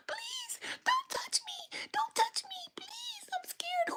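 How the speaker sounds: very high, thin squealed plea; mock-frightened falsetto whimper; imitated scared screaming. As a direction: mimic a terrified woman begging in a squeak. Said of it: "She's screaming".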